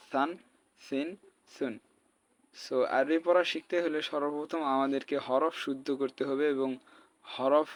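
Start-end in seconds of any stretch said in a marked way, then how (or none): 0.1-1.7 সান, সিন, সুন starting with th as in "thin"; soft lisped start on সান, সিন, সুন. Shown as a demonstration. Teacher demonstrating the soft letter, সান, সিন, সুন with th. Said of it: "আর এটা নরম".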